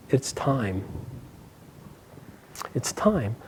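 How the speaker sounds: very soft, measured statement; hushed announcement. A narrator's reading. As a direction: say it very softly, with weight. Teacher quietly announces his demand.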